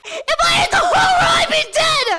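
yelling through burning pain; pained shout. Real, not acted. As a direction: shout out, half laughing, half crying. Just ate super hot chocolate.

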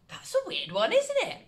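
amused surprise. Acted. Amused remark on weird sentence.

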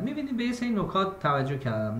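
ordinary talk

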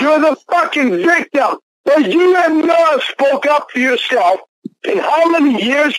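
real high-pitched outburst; angry yelling. Real, not acted. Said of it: "You're screaming".

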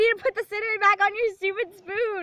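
loud, high, laughing yell; laughing shout. Real, not acted. Shouting while crying-laughing.

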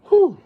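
sharp high exhale from the heat; loud high whew. Real, not acted. Reacting to spicy heat.

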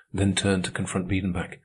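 plain reading voice

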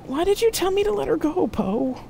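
very high, anguished question; distraught reproach. A narrator's reading. Jack asking Poe why she let Marcia go, distraught. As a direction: plead for a reason, high-voiced.

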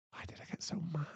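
whispered to himself; ashamed whisper; regretful whisper. Acted. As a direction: whisper it in shame. Alone, regretting his anger.

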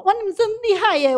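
high, loud burst of delight; excited praise. Real, not acted. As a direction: cheer them on like a proud coach. Praising the audience's answers. (兴奋地大声称赞)

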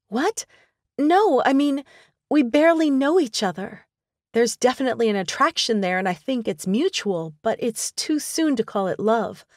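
flustered Michelle. A narrator's reading. Woman caught out by her friend, flustered denial. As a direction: brush it off too fast.

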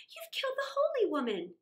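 high, shocked and accusing; horrified princess. Acted. Princess horrified at the killing, high and shocked. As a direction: accuse him in disbelief.

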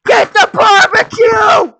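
high, loud cracking yells; frantic yelling. Retold or imitated. Spookum shrieking at Mark to get the barbecue. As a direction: scream his name in a panic.

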